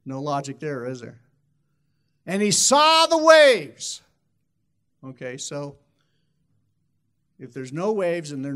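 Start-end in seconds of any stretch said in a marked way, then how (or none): 2.2-4.0 loud, high, drawn-out cry; booming storyteller's climax, pitched high; dramatic exclamation. Acted. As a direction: proclaim it in a big slow swell. Dramatic retelling of Peter sinking.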